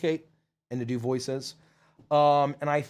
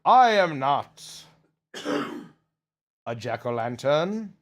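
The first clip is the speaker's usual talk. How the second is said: slow, deliberate character delivery with long pauses; lightly voiced character; 2 semitones lower, slower than usual. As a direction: draw it out, pausing between phrases.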